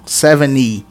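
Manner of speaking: SEVENTY as "seven-ee", falling at the end; seventy without T. Shown as a demonstration. Teacher explaining the join, seventy without T. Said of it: "como se não tivesse o T".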